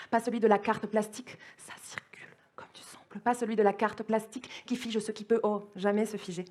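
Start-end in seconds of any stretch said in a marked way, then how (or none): 1.6-3.2 barely audible, voiceless whisper; whispered refrain. Acted. Poet repeating the blue-blood refrain to listeners, in a whisper. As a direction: whisper it like an echo.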